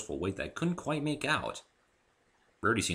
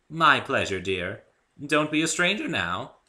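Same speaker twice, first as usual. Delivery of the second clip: Rarity voice; 7 semitones higher, 6 dB louder, slower than usual. A narrator's reading. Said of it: "Rarity speaking".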